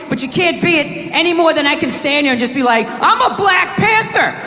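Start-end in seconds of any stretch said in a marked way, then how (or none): 2.9-4.3 slow, high-pitched slogan chanting; mock militant. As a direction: declare it like an absurd would-be activist. Mock activist declaration.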